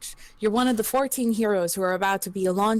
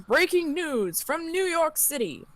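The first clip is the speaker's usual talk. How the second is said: news anchor; 7 semitones higher than usual. Acted. TV news bulletin in story.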